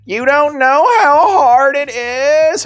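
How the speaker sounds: high, slow, mocking Scottish-North Queensland accent, laughing through it; mock the fighter in a put-on Scottish-Queensland accent. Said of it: "Her accent is horrifying".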